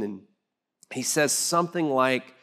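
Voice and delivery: usual speaking voice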